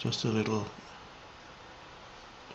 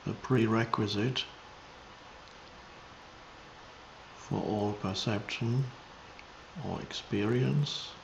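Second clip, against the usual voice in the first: meditative teaching, measured and spacious; contemplative spiritual guidance; 6 dB quieter than usual. Real, not acted. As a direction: guide listeners into presence, unhurried.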